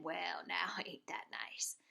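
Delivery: put-on whispered, chuckling old man; street sweeper. Street sweeper admiring the ducks, whispered chuckle. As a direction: hush it with a little laugh. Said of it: "the man who swept the street said".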